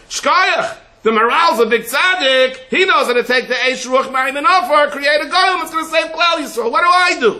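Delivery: high, loud, mocking voice of a questioner. Rabbi mocking a listener's clever question, high and loud. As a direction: squeak out the smug question.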